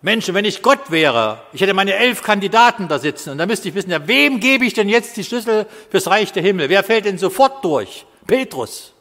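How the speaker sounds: loud, high sermon voice, slowed for emphasis; fiery preaching. Acted. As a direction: preach it to the back row. Preaching at full force.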